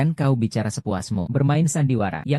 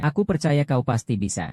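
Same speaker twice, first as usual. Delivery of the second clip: ordinary talk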